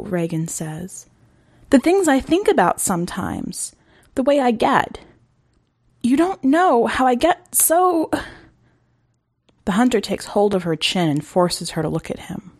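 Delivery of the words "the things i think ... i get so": put-on desperate girl. Girl insisting to the hunter she is dangerous. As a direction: plead that he can't understand. Girl's anguished protest.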